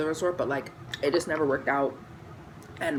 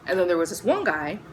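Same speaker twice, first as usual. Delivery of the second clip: ordinary talk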